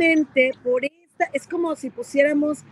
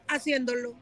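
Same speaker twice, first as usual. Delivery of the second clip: ordinary delivery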